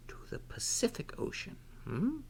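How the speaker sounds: fast, high whisper; whispered aside. Real, not acted.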